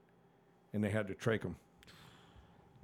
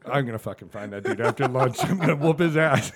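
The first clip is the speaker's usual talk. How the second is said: laughing mock threat; 2 semitones higher, 8 dB louder than usual. Retold angry threat, laughing.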